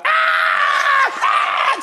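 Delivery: put-on piercing high screams of a kid being spanked; child screaming. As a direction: scream like a child getting a whooping. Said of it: "screaming like my mama was killing me".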